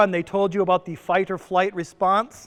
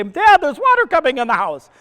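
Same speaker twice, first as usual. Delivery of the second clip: imitating a panicked daughter; 7 semitones higher, 6 dB louder than usual. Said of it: "after the tornado to say".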